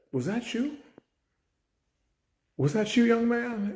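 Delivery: high, pointed questioning; teacher confronting a student; stern confronting. Retold or imitated. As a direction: catch him in the act.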